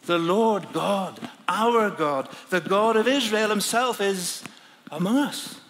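usual speaking voice

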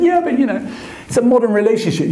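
high, quick, breezy wife voice. Retold or imitated. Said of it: "And she said".

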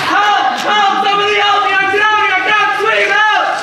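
high, loud desperate shouting; cries for help. Acted. Hammed-up drowner shouting for help from the water, high and loud. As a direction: yell for help like you're going under.

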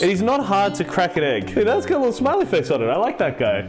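usual speaking voice

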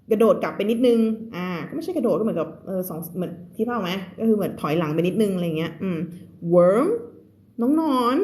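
ordinary delivery

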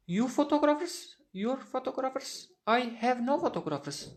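high, disbelieving questions, then a flat denial; incredulous. Acted. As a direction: scoff at the very idea. Woman denies having the photos. (inanılmaz bir şaşkınlıkla, yüksek sesle)

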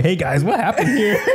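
high, laughing upbeat reporter; cheery reporter voice. Retold or imitated. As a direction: greet the crowd like a cheerful street reporter. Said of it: "he's like".

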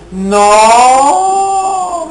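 frantic shriek; panicked scream. Real, not acted. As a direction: cry out as the air runs out. Character drowning in game.

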